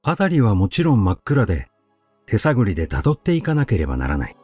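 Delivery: ordinary delivery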